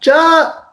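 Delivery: sharp high Hindi "Cha!" exclamation. Retold or imitated. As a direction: pop out "Cha!" like a Hindi word. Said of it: "slip in one word of".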